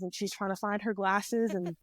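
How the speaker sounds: normal talking voice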